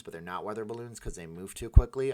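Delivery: usual speaking voice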